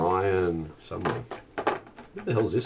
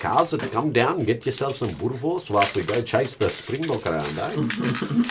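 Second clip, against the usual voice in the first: higher, lightly put-on South African accent; a casual South African host, mildly done; 5 semitones higher than usual. Inviting friends to chase springbok, light South African accent. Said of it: "I can actually do a pretty good South African accent".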